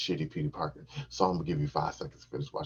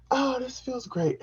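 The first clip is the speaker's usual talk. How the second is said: high squeaky imitation of her; mock pleasured woman; slower than usual. Imitating his partner mid-act, high mock coo. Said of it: "She was going through it".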